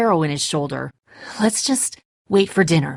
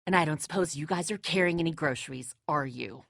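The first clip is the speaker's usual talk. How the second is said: much softer, lower, dry sneer; quiet sarcasm; 4 semitones lower, 10 dB quieter than usual. Acted. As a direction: needle the newcomers under the breath. Sneering at newcomers about food.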